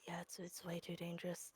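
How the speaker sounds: hushed whisper about danger. Pretend. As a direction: whisper it like you're hiding. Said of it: "She is whispering".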